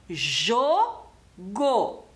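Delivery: Jogo split into a long JO, pause, then GO; syllable by syllable. Shown as a demonstration. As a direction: break Jogo into two drawn-out beats, jo... go. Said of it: "quantas vezes a gente abre a boca pra falar".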